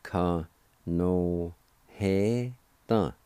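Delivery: ka no he ta broken into slow separate syllables; slow teaching demo of Cherokee. Shown as a demonstration. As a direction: sound out ka no he ta one piece at a time. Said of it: "Second part".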